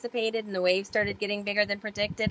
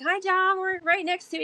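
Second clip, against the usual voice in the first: cheery radio hail; 11 semitones higher than usual. Retold or imitated. Calling mentor on marine radio.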